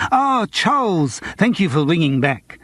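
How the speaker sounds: high, slow, drawn-out grand greeting; lightly grand theatrical agent. A narrator's reading. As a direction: put on airs like a pompous agent. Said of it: "said Morris grandly".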